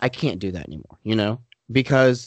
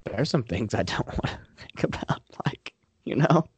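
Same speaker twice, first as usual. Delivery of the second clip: whispered confession; whispering; 5 dB quieter than usual. Real, not acted. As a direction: drop to a whisper. Admitting things he avoids.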